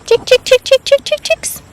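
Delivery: high sing-song chick chick call; calling chickens. Real, not acted.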